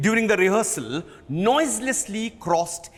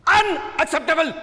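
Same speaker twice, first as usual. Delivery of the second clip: roared; 9 semitones higher than usual. Acted. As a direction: let it explode. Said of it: "He roared".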